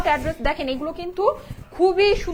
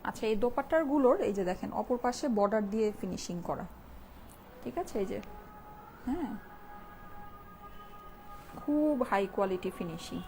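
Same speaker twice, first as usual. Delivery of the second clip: hushed, softer voice during the call to prayer; speaking softly; 10 dB quieter than usual. Real, not acted. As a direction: keep your voice down out of respect. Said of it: "আমি একটু আস্তে কথা বলি". (আজানের সময় নিচু গলায় আস্তে কথা বলা)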